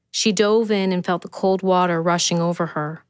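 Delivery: usual speaking voice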